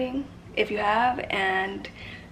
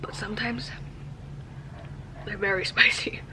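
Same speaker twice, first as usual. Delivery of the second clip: laughing whisper. Real, not acted.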